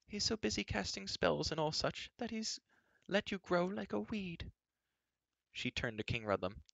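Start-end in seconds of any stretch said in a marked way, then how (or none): 0.1-4.5 high, disapproving queen voice; tut-tut at the girl's state; fussy queen. A narrator's reading. Queen complains about Dolben.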